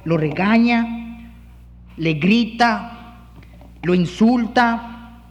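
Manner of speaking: slow and weighty, each verb hit; preacher slowing for emphasis; slow emphatic. Real, not acted. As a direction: drive each verb home slowly. Preaching on scolding.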